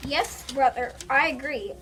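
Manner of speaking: ordinary delivery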